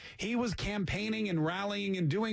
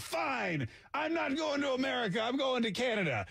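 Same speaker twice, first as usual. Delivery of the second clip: higher-pitched mock indignation; mock huffy grandfather; 6 semitones higher than usual. Grandfather quitting the American line after a fight, mock huffy. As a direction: storm off in a lightly acted huff. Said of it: "he was like".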